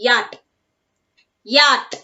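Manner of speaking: "yacht" as YAT, no CH; silent CH. Shown as a demonstration. Teacher showing the class how to pronounce it, silent CH in "yacht". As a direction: drop the CH from "yacht". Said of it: "so we have to pronounce this as".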